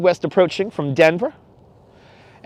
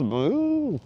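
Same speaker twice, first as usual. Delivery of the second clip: cow moo; 6 semitones higher, 9 dB quieter than usual. Retold or imitated. Answering flying drone cows.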